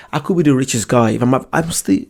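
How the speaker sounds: ordinary talk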